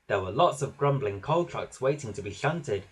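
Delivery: usual speaking voice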